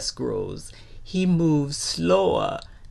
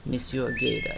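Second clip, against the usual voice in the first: plain reading voice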